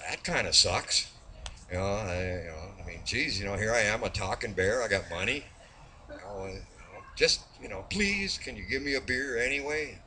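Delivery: lightly voiced sulky bear, low and soft; dejected pleading. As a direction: plead softly, a little let down. Bear pleads after being refused.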